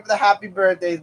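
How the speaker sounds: usual speaking voice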